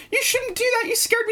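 put-on high, shaken maid scolding the child. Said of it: "the maid is like".

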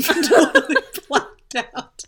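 laughing at herself. Real, not acted. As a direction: laugh through the words. Laughing, louder.

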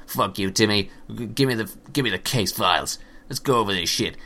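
gruff New York wise-guy detective, lightly done; a tough-talking New York gumshoe brushing off his partner; New York detective accent. Pretend. Pikachu as a New York detective talking down to Timmy, New York accent. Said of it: "Pikachu randomly speaking with a New York detective accent".

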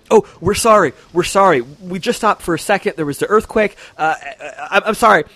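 high, pleading, tripping over words; scared workers apologising to their boss; frantic apologies. Acted. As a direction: grovel in a panicky rush. Workers caught, begging apology.

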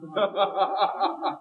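light chuckle at his own joke; short laugh. Real, not acted. Preacher laughs at his own joke, light chuckle. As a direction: laugh at the congregation. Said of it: "sometimes I wish he'd do it to you".

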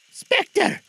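put-on very high, loud old Scrooge voice; urgent Scrooge. Scrooge hailing the spirit, high urgent appeal.

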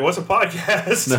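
put-on high, slow puzzled old woman voice; lightly voiced confused lady; mock puzzled lady. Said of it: "She'd be like".

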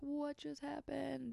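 high, drawn-out mock scream, kept soft; stretched high wail, held back; retold scream. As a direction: mimic screaming without the volume. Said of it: "screaming, like".

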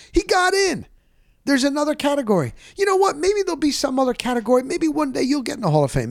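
high, fast mock consolation; teasing consolation. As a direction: rib a friend with fake comfort, high and fast. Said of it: "And I said".

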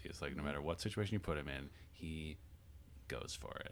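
fading, fast, to a whisper. Real, not acted. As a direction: let it fade into a whisper. Trailing off into whisper.

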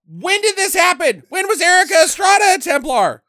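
can't believe the movie; incredulous. Real, not acted. Host ranting at the movie, incredulous and loud.

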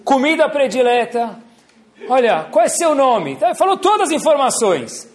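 high, loud, laughing agent voice; call centre agent. Retold or imitated. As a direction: fire off the form questions like a bored agent. Call centre agent rattling off questions.

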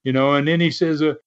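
usual speaking voice

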